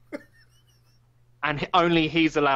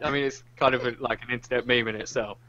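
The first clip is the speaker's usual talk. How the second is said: ordinary talk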